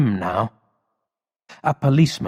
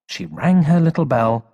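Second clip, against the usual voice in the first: plain reading voice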